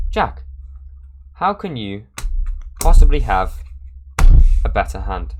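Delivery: retold shocked players; incredulous protest. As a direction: protest the impossible hand. Players protest his winning hand.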